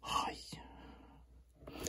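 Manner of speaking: ordinary talk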